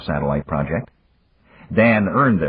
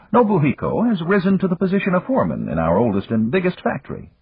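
higher, unhurried voice of an amused magnate; Yamagata; 13 semitones higher than usual. A narrator's reading. Yamagata chiding Dan for the early call, higher and amused. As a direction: tease an old friend from a position of power.

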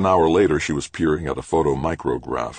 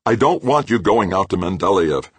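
louder, raised-pitch insistence; stubborn; 6 semitones higher, 5 dB louder than usual. A narrator's reading. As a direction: insist stubbornly, pushing the words out. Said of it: "Grant repeated stubbornly".

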